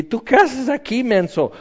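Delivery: scoffing voice calling someone a fool; mocking scoffer. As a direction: sneer at the impostor from on high. Imagined voice mocks an impostor.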